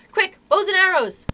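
high, loud call to arms; urgent shout. A narrator's reading. Boys rushing for weapons, urgent shout. Boys rush to arm themselves.

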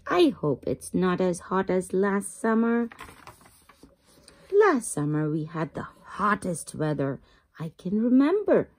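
plain reading voice

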